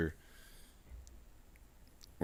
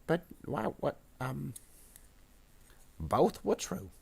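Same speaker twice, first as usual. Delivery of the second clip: high, hesitant grot voice; cornered grot minion; 8 semitones higher, slower than usual. Acted. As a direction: stammer a squeaky answer to the boss. Grot answers under death threat.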